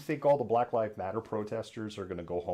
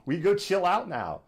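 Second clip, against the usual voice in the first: higher, casual mocking voice; a higher mock Proud Boy, shrugging it off; 9 semitones higher, 3 dB louder than usual. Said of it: "Proud Boys are gonna be like".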